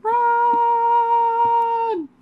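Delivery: very high, loud alarmed scream; grenade warning yell. Real, not acted. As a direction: scream at the team to flee. Grenade incoming.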